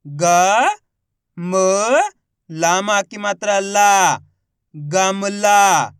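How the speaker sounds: ग, म, long ला, then गमला drawn out; slow spelling into a stretched गमला; letter by letter. Shown as a demonstration. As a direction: sound out each letter, then blend slowly. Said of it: "किस तरीके से इनका उच्चारण करके".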